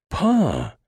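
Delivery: very high, loud shriek; crying out. A narrator's reading. Daughter crying out to her father. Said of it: "Banshee cried again".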